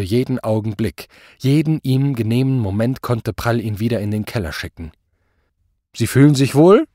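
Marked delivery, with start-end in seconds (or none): 5.9-6.9 loud, rising question; menacing pleasantry. A narrator's reading. Superior asks the cowed commissioner, loudly needling. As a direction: probe with false concern.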